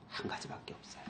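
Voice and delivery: voiceless whisper for the key answer. Real, not acted. Preacher revealing the only way to the congregation, whisper. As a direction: drop to a secretive whisper.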